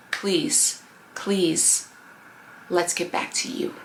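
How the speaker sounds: hushed, earnest plea to the listener; soft pleading. Real, not acted. Pleading with the viewer.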